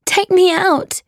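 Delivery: high-pitched desperate plea; scared child crying out; frightened cry. Acted. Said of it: "I cried".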